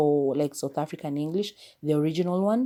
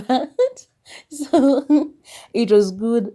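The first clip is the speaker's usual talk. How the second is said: laughing; 8 semitones higher, 10 dB louder, much slower than usual. Real, not acted. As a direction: laugh through it, slow and loud. Laughing about the highway scene.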